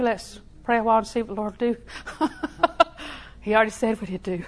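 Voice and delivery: ordinary delivery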